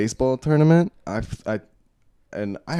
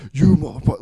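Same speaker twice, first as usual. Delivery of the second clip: higher-pitched mock yell; retold scream; 6 semitones higher, 4 dB louder, faster than usual. Dad storming out of the bedroom, mock angry scream. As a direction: imitate an angry yell, held back.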